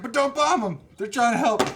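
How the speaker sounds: high, loud, hammed-up protest; mock frantic plea. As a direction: cry out in a squealed mock plea. Heroine pleading against bombing.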